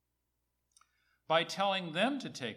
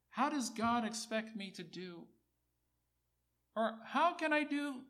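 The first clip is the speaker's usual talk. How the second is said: higher-pitched, overwhelmed questioning; mock-helpless protest, climbing higher; acted dismay; 8 semitones higher, 3 dB louder than usual. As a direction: act out someone daunted by an impossible command. Voices believer daunted by command.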